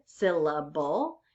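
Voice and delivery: SYLLABLE slowed into three clear beats. Shown as a demonstration. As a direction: say "syllable" slowly so the three beats can be counted. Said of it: "three syllables".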